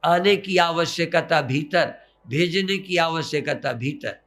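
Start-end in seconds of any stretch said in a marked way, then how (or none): none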